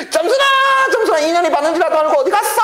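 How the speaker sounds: mother yelling for her daughter to come home; calling out. Acted. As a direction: shout for the girl across the hillside.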